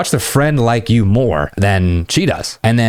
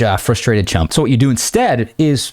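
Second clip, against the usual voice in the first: usual speaking voice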